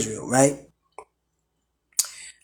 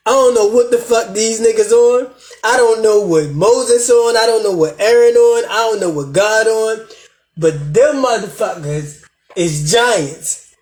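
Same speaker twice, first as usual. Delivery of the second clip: higher-pitched mock complaint; mock exasperation; 5 semitones higher than usual. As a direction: act out a fed-up crowd grumbling. Acts out the fed-up congregation's complaint.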